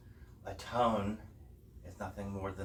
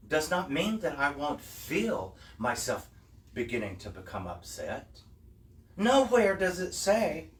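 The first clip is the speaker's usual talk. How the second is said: higher, louder preacher build; impassioned preaching; 5 semitones higher, 4 dB louder than usual. Acted. Teacher urging listeners to own their anger, preaching louder and higher. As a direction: drive the point home to the congregation.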